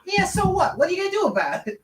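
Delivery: put-on high, fast tough baby voice; taunting rat baby. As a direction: sneer it like a cocky rat baby.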